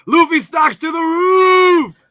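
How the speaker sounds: high, slow, loud cheering cry; hyped shout. Acted. Cheering co-player about Luffy, high drawn-out shout. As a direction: belt it out like a crazed fan.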